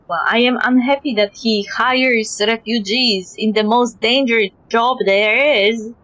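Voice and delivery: ordinary talk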